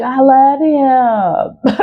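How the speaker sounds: high, long drawn-out swoon; hammed-up lovesick whine; mock lovestruck. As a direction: draw it out, helplessly smitten. Mocking her lovestruck past self.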